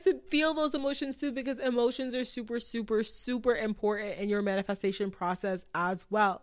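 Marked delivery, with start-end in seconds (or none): none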